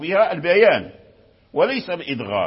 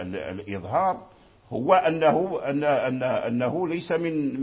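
normal talking voice

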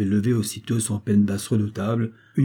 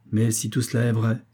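usual speaking voice

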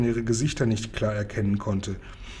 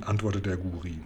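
usual speaking voice

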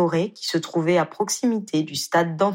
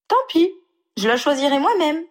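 much higher, louder firm decree; decisive declaration; 10 semitones higher, 4 dB louder than usual. Acted. Ginny to Harry about choosing the ring, high and decisive. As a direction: settle it with a playful ruling. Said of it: "décréta-t-elle".

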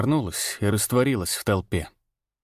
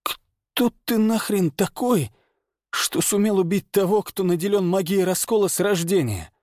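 angry injured man; 9 semitones higher than usual. A narrator's reading. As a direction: demand it through the pain. Injured man challenges the stranger.

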